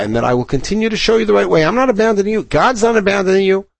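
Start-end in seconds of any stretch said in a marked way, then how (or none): 1.6-3.7 higher, pressing hard on not; insist, not abandoning you; emphatic reassurance. Acted. Shmuel promises the people he stays, with emphatic stress.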